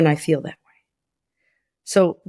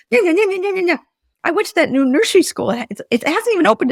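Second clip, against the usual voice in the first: high, fast, tumbling words; excited rush; 11 semitones higher, 3 dB louder, faster than usual. Retold or imitated. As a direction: keep the frantic pile-up going. Continuing her retold outburst.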